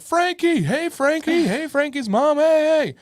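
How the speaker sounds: high, loud mock greeting of coaches; calling out. Coaches greet a well-known kid.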